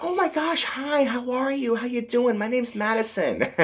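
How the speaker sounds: put-on very high-pitched gushing girly greeting; Madison. Comic relief character meets Columbus, high gushing imitation. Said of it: "Madison's like".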